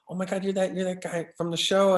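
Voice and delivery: high-pitched imitation of an excited fan; starstruck fan. Woman recognizing an actor at pizza, starstruck. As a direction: imitate a fan's surprised recognition.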